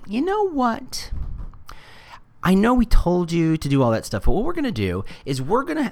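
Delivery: high, put-on voice breaking the news; mock company announcement. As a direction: act out a company backtracking, pitched high. Voicing Facebook breaking news to brands.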